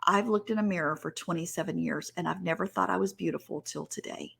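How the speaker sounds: soft, hushed retelling of a tearful confession; subdued after sobbing. Retold or imitated. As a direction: let it fade almost to a whisper. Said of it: "she sobbed for a little while".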